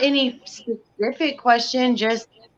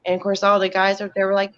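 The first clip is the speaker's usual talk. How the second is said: ordinary talk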